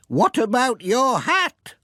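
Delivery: put-on high, slow old lady voice; fussing, grateful grandmother; Granny Dryden's voice. Old lady thanking the postman for chasing her washing, high and fussing. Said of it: "said Granny Dryden".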